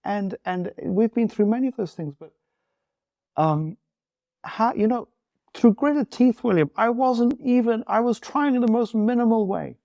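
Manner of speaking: talking through rising tears; tearing up. Real, not acted. Recalling the moment his marriage turned, tearing up for real. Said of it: "I'm tearing up right now".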